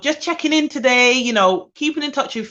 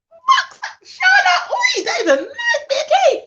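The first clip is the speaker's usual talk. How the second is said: very high, loud, laughing cry; laughing outburst; 3 dB louder than usual. Real, not acted. Enjoying her tea, she shrieks with laughter. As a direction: burst out laughing over the tea.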